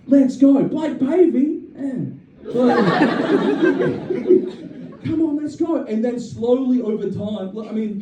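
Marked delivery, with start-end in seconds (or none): 0.1-5.8 high, eager let's-go energy; excited driver. Retold or imitated. Driver setting off with his passenger, excited. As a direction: cheer the ride along, pitched up. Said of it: "He was excited".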